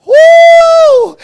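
very high shouted woo; ecstatic shout. Real, not acted. Preacher rejoicing before the congregation, loud high woo. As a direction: throw out a big joyful woo.

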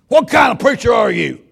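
loud, high, pressing question; demanding big man. Retold or imitated. Big preacher demanding what kind of preacher, loud and high.

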